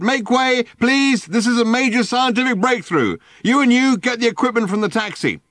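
high, loud through a megaphone; megaphone bawling. A narrator's reading. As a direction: bawl at the crowd via loudhailer. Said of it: "bawling at the crowd through it".

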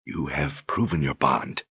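soft, slow, hushed; whisper. A narrator's reading. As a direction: whisper it as he holds her. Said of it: "he whispered".

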